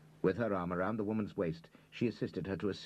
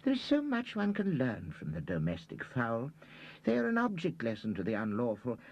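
put-on high fussy old man; 7 semitones higher than usual. A narrator's reading. Reader rambling about chickens.

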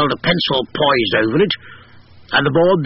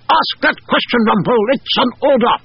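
parade ground bellow; 12 semitones higher than usual. A narrator's reading. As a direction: bellow it like a drill sergeant. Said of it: "almost in a parade ground bellow".

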